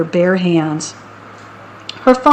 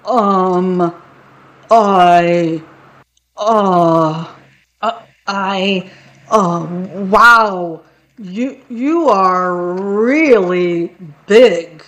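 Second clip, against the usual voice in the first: stammering, scared troll; much slower than usual. Acted. Troll stammers at the big goat.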